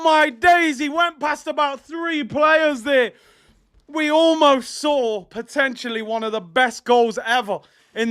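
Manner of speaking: rising from urgent call to loud high shouting; building to a shout. Real, not acted. Streamer calling the last attack of the final, building to a scream. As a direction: build with every step of the dribble and explode.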